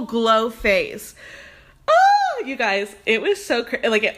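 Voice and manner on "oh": very high, loud drawn-out "oh"; excited squeal. Real, not acted. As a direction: squeal like an overwhelmed fan. Overwhelmed after telling the celebrity encounter.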